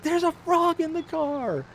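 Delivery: imitating the wife hollering in falsetto. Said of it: "on the side of the road hollering".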